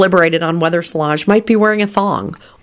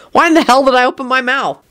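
high, exasperated disbelief; incredulous; 8 semitones higher than usual. A narrator's reading. Quentin appalled by his own question, incredulous. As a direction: wail it inwardly, regretting asking. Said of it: "Quentin thought incredulously".

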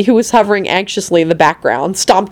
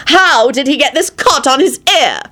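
alarmed cry; 8 semitones higher than usual. A narrator's reading. Elizabeth alarmed over another injury.

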